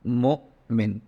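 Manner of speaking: "Mu'min" split as Mu, slight pause, min; slight pause mid-word. Shown as a demonstration. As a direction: stop briefly after the Mu in "Mu'min". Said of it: "just a slight pause and then Min".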